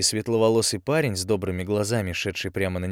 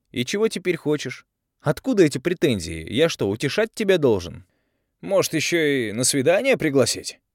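sarcastic retort; 6 semitones higher, 4 dB louder than usual. A narrator's reading. Andrey sarcastically rebuffs her.

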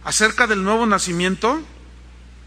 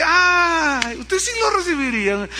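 surprised question; 10 semitones higher than usual. Retold or imitated. Preacher quizzing a newcomer in the second row, mock surprise. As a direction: act startled at her answer, pitched up.

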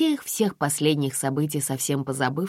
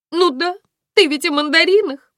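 high, loud whimpering explanation; tearful; 8 dB louder than usual. A narrator's reading. Violetta explains herself to Aglaya, tearful. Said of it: "Виолетта всхлипнула".

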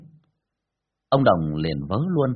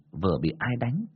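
ordinary delivery

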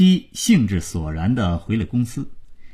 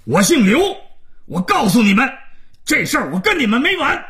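very high-pitched irate shouting; outraged caller at breaking point; frantic rage. A narrator's reading. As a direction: threaten them, beside yourself. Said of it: "刘总在电话那头已经抓狂了".

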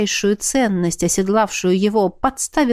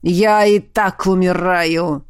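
dying dragon; 3 semitones lower, slower than usual. A narrator's reading. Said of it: "проговорил Ювеналий".

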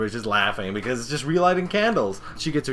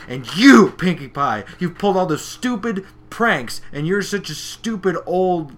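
raised, emphatic telling-off; mock mad accusation; 6 semitones higher, 6 dB louder than usual. Gilda turning on Pinkie Pie over pranks, mock fury. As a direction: punch "you" hard, then rail on higher. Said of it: "she's getting all fucking mad".

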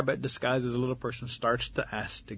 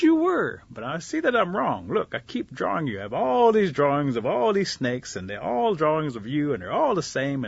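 higher, louder, insistent rabbit; animated pleading; 5 semitones higher, 5 dB louder than usual. Acted. Rabbit explains his drawings to the snake, animated. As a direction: press your case with rising energy.

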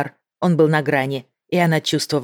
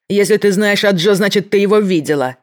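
loud, high furious accusation; angry shout; 5 semitones higher, 5 dB louder than usual. A narrator's reading. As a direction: keep yelling at her. Mankuza keeps shouting at Maggie.

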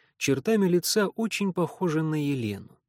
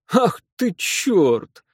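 laughing exclamation; 6 semitones higher, 7 dB louder, much slower than usual. Acted. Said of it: "Он неожиданно расхохотался".

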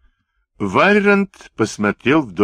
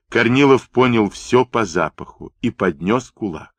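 ordinary delivery, nothing marked

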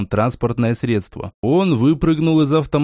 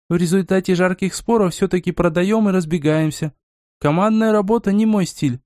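higher-pitched female character, setting terms; self-assured woman companion; 5 semitones higher than usual. Acted. Narrator voices the woman companion. (уверенная девушка, голос повыше)

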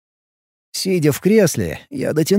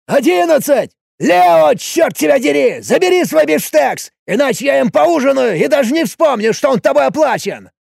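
loud, high bellowing barman; bartender shouting; 11 semitones higher, 4 dB louder than usual. Acted. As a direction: yell it across a noisy pub. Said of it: "выкрикивал номер".

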